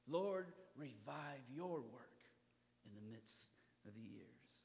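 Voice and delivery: slow, soft, fading prayer; quiet prayer. Shown as a demonstration. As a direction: pray it gently, falling to a hush. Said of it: "this is to be our New Year resolution".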